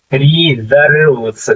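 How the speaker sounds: Reserves stretched out, री held long, then जर्व्स; slow sounding-out. Shown as a demonstration. Teacher showing students how reserves reads, slowly stretched. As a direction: draw reserves out slowly, part by part. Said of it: "क्या हो जाएगा".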